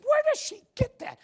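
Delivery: high, loud shocked cry; astonished mother. Retold or imitated. Christina asking where her daughter learned it, shocked and loud. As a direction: shriek it in disbelief. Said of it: "And, and Christina said".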